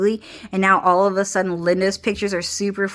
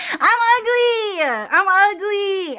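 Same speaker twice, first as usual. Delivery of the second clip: high, slow hammed-up whine; fake-sob it way up high like a sulking child; mock wail; 12 semitones higher, slower than usual. Protesting friends' compliments, high mock crying wail.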